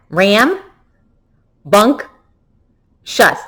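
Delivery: ram, bunk, shuss split into three separate beats; syllable by syllable. Shown as a demonstration. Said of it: "Let's do that one more time".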